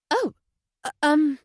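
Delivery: stumbling for words, caught out; flustered wife. A narrator's reading. Wife on the intercom, flustered by the detective. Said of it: "the voice sounded flustered now".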